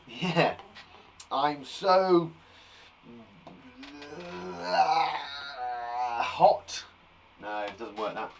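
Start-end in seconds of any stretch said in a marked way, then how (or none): 1.3-6.8 halting, slightly hammed-up crossness; mock anger. Shown as a demonstration. Teacher searching for angry synonyms, mock angry. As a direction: act angry while groping for the word.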